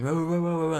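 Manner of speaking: high mock babble of shouting; yelling crowd. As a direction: imitate everyone yelling at once. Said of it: "everybody's yelling".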